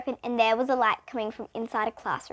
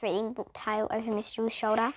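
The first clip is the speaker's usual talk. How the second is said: usual speaking voice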